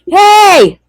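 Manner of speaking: high, loud mock parent's yell; yelling out the door. Parent calling children in from outside, yelling. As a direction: holler it as if from the doorway. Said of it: "Just yell out the door".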